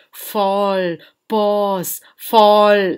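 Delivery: long, separated words with pauses; slow flow. Shown as a demonstration. Teacher demonstrates how the stream flows, slow and halting. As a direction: draw out each word, pausing between them. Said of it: "Like that it is flowing".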